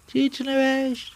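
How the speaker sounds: high, slow comic henchman; a squeaky sidekick straining to see; high nasal lackey voice asking; Ayyub's high voice. Acted. Ayyub asks, character voice.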